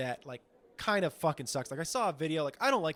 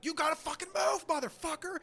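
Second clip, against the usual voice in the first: much higher, furious yelled order; mock angry shout; 13 semitones higher than usual. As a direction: blast them out of the way. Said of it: "he's shouting at these people".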